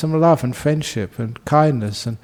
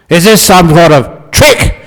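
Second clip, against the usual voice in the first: 1 suspicious thief yell; 5 semitones higher, 11 dB louder, slower than usual. Acted. Burglar suspects the abbot.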